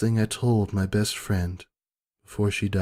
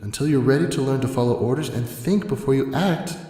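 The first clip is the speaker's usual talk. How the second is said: plain reading voice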